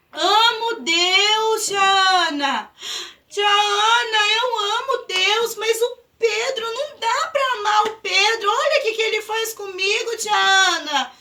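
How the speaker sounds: high, crying complaint to the teacher; tearful child. Acted. Pedro complains to tia Ana, crying. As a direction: whine and sob it out to tia Ana.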